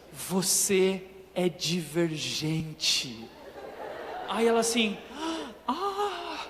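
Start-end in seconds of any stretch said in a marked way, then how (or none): 0.1-3.3 very slow, low and grave; dramatic reveal. Retold or imitated. Test nurse revealing the result, slow and ominous. 5.7-6.4 much higher, breathy shocked ah; shocked gasp. Retold or imitated. Heroine hearing she is divergent, high shocked gasp.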